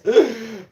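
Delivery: loud high gasp; mock gasp. Ending his retold recoil from the lady with a loud gasp. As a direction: gasp as if shocked.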